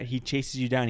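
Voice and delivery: usual speaking voice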